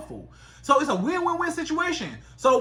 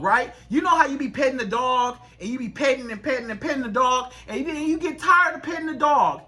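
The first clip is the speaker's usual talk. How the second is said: normal talking voice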